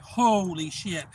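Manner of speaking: drawn-out, stunned and awed; slow amazed exclaim. Retold or imitated. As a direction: let the words stretch out in disbelief. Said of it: "I'm like".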